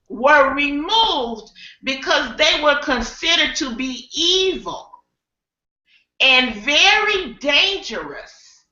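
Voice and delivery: slow, deliberate quizmaster delivery; dramatic question reading. Acted. Host poses a question to the panel, slow dramatic reading. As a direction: draw out each phrase with long pauses.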